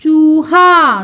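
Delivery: चूहा very slow, both vowels stretched; pronunciation demo. Shown as a demonstration. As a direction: chant out चूहा for pupils to repeat. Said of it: "मेरे पीछे पीछे करो उच्चारण".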